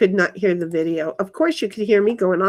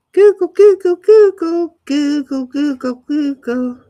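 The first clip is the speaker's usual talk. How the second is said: loud, much higher calls of Google; calling out; 5 semitones higher, 8 dB louder than usual. Real, not acted. Calling the word out to the screen, loudly. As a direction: belt out Google like summoning it.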